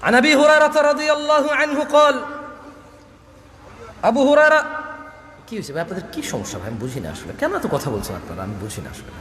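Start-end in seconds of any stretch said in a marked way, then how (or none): 5.5-9.1 a softer, lower, fed-up plea; quiet exasperated complaint. Real, not acted. Preacher to a chattering crowd, quiet exasperated complaint. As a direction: drop the voice and chide the crowd, low and weary.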